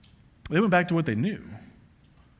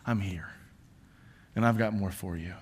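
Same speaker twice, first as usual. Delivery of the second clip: gentle reassurance; 8 semitones lower, 7 dB quieter than usual. Retold or imitated. God's gentle reply.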